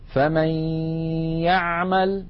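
فمن يعمل recited with a held nasal glide from فمن into the y of يعمل; nasal ghunna kept. Shown as a demonstration. Teacher asking students to note the ghunna, nasal merge in فمن يعمل. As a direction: keep the nasal hum while gliding into يعمل. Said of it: "لاحظوا بقاء الغنة".

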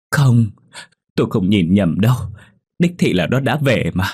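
a desperate insistence that she saw the ghost; insistent protest. Acted. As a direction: refuse to be doubted. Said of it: "cố giải thích cho mọi người hiểu".

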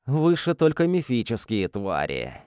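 ordinary delivery, nothing marked

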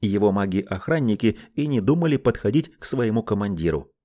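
ordinary delivery

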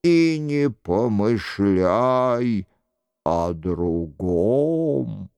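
low, slow commandment; understated wrath, intoned deep and drawn out; stern anger. A narrator's reading. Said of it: "с гневом произнёс голос".

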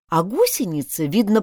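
ordinary delivery, nothing marked

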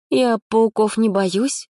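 slow, high, offhand; slow careless shrug of a line; feigned indifference. A narrator's reading. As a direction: pretend not to care. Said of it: "как можно равнодушнее".

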